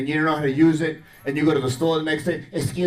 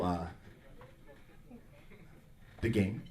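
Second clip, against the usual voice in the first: sheepish mumble; 9 semitones lower, 17 dB quieter than usual. Retold or imitated. Guilty quiet reply.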